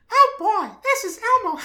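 very high, loud squeaky Elmo; dad imitating Elmo in a very high voice. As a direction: squeak it out like the puppet. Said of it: "my little Elmo voice".

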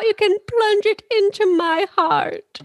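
high, slow theatrical lament; milk the tragedy, slowly; melodramatic high. Retold or imitated. S1 quotes Moira's line.

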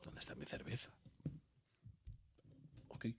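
whispered aside. Real, not acted. Looking for his beer mid-show, a whispered aside. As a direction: breathe it as a private aside.

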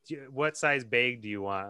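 "bag" said as "bayg" in a quoted question; regional BAG vowel. Retold or imitated. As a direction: make "bag" rhyme with vague. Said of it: "'cause they say bag".

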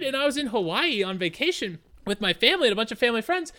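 ordinary delivery

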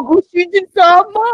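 high anime voice, half laughing; giggly sugary maid greeting; cute anime maid voice. Shown as a demonstration. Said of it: "Okairi Toshijin-sama".

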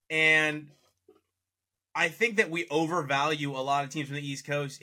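ordinary talk

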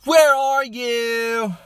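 very high, loud, slow nasal pop-punk whine. Retold or imitated. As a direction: stretch it out like a mocking pop-punk singer. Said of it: "the Blink one eighty-two voice".

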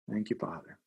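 hushed, soft prayer; prayerful. Real, not acted. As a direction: murmur it softly to God. Prayer during breathing exercise.

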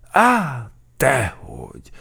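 high, loud startled start, falling away; alarmed denial. A narrator's reading. Sergeant accused of gaining weight, alarmed. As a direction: blurt a frightened denial. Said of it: "ijedt meg az alőrmester".

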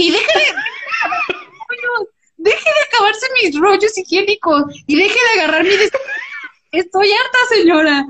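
high, indignant mock outburst; enojo actuado. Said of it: "estaba tan enojada que se me salió". (reclamo furioso, agudo y exagerado)

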